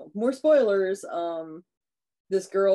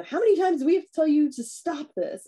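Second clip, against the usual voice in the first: higher and louder scolding call; mock mom yelling; 10 semitones higher, 5 dB louder than usual. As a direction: yell upstairs like a fed-up mom. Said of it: "yells up for the daughter".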